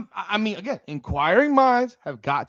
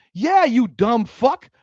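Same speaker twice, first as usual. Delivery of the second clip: ordinary talk, nothing marked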